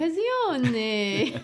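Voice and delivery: slow, laughing Italian accent. Shown as a demonstration. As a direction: say it the Italian way, drawn out. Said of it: "as you say in your Italian accent".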